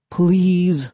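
high, drawn-out plea; pleading. A narrator's reading. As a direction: beg like a little kid. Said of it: "I pleaded".

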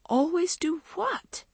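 high, drawn-out incredulous question; exasperated bafflement. A narrator's reading. Maggie is accused of always doing something, baffled. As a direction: stretch it out, pitch soaring on the question.

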